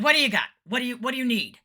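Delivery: loud, high, punchy questions; brisk take-charge. Retold or imitated. Sound guy asking the singer's needs, loud and brisk. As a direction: fire it out as the no-nonsense tech.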